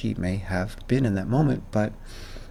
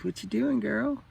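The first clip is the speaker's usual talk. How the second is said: much higher sing-song coo to a pet; high cooing pet voice, softer; 11 semitones higher, 4 dB quieter than usual. Real, not acted. Talking to the kitten.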